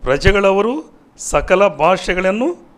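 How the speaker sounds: loud, high, stretched-out preaching; rousing scripture proclamation. Acted. Preacher shows viewers the great multitude in heaven, rousing proclamation. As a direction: punch each phrase out with rising endings.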